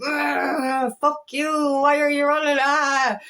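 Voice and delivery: growled "ugh" into a retold angry outburst; imitated angry groaning and griping; mock bitter anger. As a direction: act out a frustrated rant at the one running. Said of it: "stages of anger and bitterness going".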